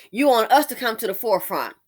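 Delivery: usual speaking voice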